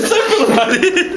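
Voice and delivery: stretched high giggly taunt; high laughing singsong. Real, not acted. Laughing at friend spitting drink.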